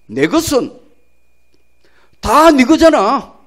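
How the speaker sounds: slow, loud Gyeongsang dialect father. Acted. Father answers elder son in slow, loud Gyeongsang dialect. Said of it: "이 경상도 말이라".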